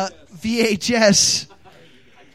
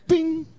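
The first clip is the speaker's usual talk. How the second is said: high sung bing like a bell; bell sound; 9 semitones higher than usual. Pretend. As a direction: imitate a bell ding. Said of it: "I don't have a bell is the only problem".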